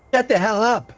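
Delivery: high-pitched annoyed woman giving orders; woman's voice. Acted. Woman silencing her noisy camp, high commanding voice. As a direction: snap it high, as the woman in charge. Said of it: "the woman's voice".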